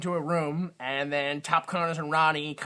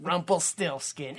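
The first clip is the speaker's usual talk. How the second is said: usual speaking voice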